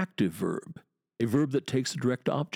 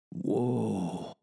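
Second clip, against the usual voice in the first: drawn-out woe. Shown as a demonstration. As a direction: let the vowel swell and linger. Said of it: "you have to say the poetry, hear it".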